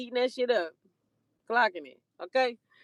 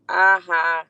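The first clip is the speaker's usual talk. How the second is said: taunting aha, punched; mocking aha; 5 semitones lower, 10 dB louder than usual. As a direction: throw a smug aha. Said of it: "I said".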